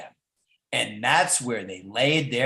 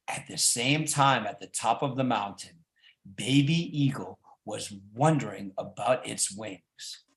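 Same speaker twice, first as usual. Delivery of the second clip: usual speaking voice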